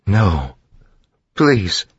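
high, desperate begging; pleading. A narrator's reading. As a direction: beg for mercy. Vin begs in horror.

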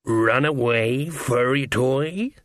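slow, drawn-out taunt; a cat playing with its catch; sly cat-man. A narrator's reading. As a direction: toy with your prey, drawing each word out. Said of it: "he suggested".

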